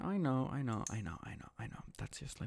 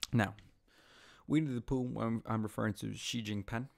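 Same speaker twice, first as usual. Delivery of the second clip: ordinary talk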